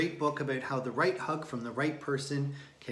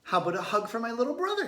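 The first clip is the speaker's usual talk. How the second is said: big sister asking; 9 semitones higher, 5 dB louder, much faster than usual. A narrator's reading. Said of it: "said Thea".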